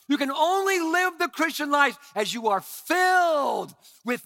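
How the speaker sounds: loud, high, shouting sermon; full-force preaching. Acted. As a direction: preach it out with fire.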